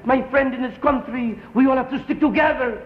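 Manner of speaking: put-on high, earnest pep talk in a thick Spanish accent; rally the gang in a heavy Spanish accent. Gang leader rallying the guys, thick Spanish accent. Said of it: "his Spanish accent is kinda dope".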